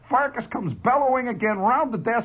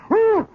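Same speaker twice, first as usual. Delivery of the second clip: loud, high imitated bellow; 8 dB louder than usual. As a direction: let out a whooping charge bellow. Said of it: "he lets another bellow out".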